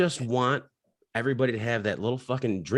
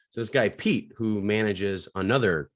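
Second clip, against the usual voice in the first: normal talking voice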